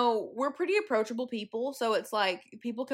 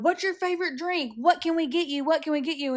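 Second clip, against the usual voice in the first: high, insistent repeated offers; imitated eager badgering; persistent strangers; 5 semitones higher than usual. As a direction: pester them like pushy strangers. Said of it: "they're very persistent".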